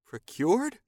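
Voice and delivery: high, rising, indignant question; vehement echo. A narrator's reading. Captive man hearing he was procured, vehement. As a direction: fling the word back in outrage. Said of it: "the vehemence of the man's words".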